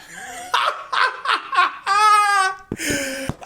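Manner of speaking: high, loud laughing outburst; triumphant laughter. Real, not acted. Gambler celebrating a Little League win, triumphant laughter. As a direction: cackle high with delight at a win.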